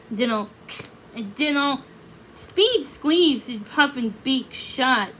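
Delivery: ordinary delivery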